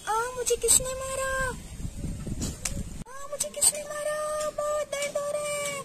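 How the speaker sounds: very high, acted cry of pain; high-pitched hurt whimpering cry; pained wail. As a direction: wail as if just struck. Girl is struck, in pain.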